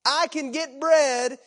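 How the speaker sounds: loud, urgent sermon delivery; forceful preaching. Acted. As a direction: drive it home from the pulpit.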